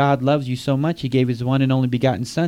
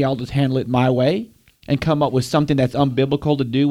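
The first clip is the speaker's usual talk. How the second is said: usual speaking voice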